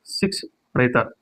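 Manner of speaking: शिक्षणेतर drawn out slowly and softly, each syllable clear; slow correct pronunciation. Shown as a demonstration. Teacher to exam students, slow soft model word. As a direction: stretch शिक्षणेतर out slowly and quietly. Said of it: "शिक्षणेतर होता है".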